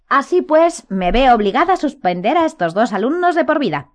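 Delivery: high, smug pronouncement; sentencing. A narrator's reading. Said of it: "sentenció".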